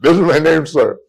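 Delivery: mock-frustrated near-yell of his name; frustrated outburst. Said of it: "now he's getting frustrated".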